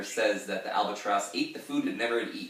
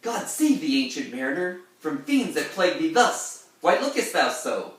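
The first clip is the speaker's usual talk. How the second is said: put-on higher, louder alarmed young man; wedding guest; 7 semitones higher, 6 dB louder than usual. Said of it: "the wedding guest chimes in here".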